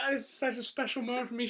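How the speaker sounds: high mock-emotional gush; exaggerated weepy girlfriend impression; imitated happy crying. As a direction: act tearfully touched, in a put-on high voice. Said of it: "She actually started crying".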